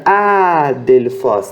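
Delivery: "Adelphos" with a long, stressed opening A; stress on first syllable. Shown as a demonstration. Teacher answering which syllable is tonic, first A of "Adelphos" stressed. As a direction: stretch the A of "Adelphos", then fall away. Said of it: "qual que vai ser a sílaba tônica?".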